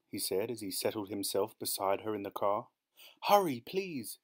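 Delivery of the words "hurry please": high, urgent request; urgent plea. A narrator's reading. Late wife to her chauffeur, urgent plea. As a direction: press the driver to move.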